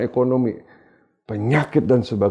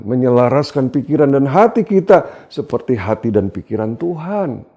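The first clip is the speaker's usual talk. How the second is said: normal talking voice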